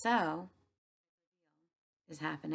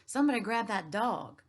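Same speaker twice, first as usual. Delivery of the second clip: higher, louder scream; 4 semitones higher, 6 dB louder than usual. Acted. Panicked manager yelling for staff, loud scream. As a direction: scream for someone to grab the dog. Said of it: "The manager screamed".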